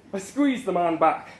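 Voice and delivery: plain reading voice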